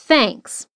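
Thanks with the tongue between the teeth; th sound. Shown as a demonstration. Teacher demonstrating the th in Thanks. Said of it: "a língua entre os dentes pra falar o T H".

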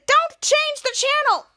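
high, drawn-out shouted demand; mock yell. [name] stopping Jake at the remote, high yell.

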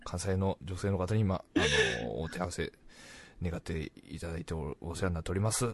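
low, soft, bashful mock formality; play-acted timid politeness, voice dropped; humble self-introduction. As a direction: introduce yourself meekly, as if starstruck.

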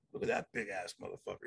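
low, soft awed aside under the breath. Retold or imitated. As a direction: mutter it to yourself in awe. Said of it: "I'm like".

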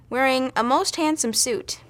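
ordinary delivery, nothing marked

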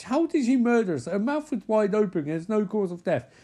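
high-pitched argumentative protest; incredulous. Acted. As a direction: argue it in mock disbelief.

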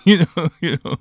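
words breaking up with laughter; laughing through words. Real, not acted. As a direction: laugh it out loud while talking. Laughing at his own story.

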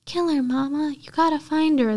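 high mock child voice; Jason voice. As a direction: lift the pitch like a spooky little boy. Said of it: "doing the Jason voice again".

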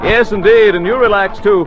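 usual speaking voice

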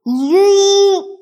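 high, loud shouted yeet. Acted. Author yelling yeet to annoy the narrator. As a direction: blast the yeet out to annoy everyone. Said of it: "Ugh, you're so annoying".

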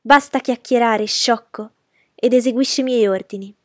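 haughty, irritated command; impatient scolding. A narrator's reading. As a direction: scold the servant into obeying. Haughty lady scolds servant.

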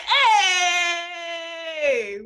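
long, high, loud hey; celebratory shout. Pretend. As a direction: holler it out in triumph, drawn out. Imagined celebration of owning home.

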